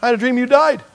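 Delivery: high, loud, laughing wife telling her dream; wife still laughing from her dream. Retold or imitated. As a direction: relay the dream through giggles. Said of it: "She goes".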